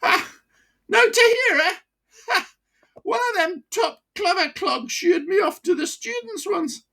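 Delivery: put-on high, broad Wearside voice of an old miner; chatty old pitman with a wheezy chest. Old miner to his doctor, broad Wearside. Mickey boasts.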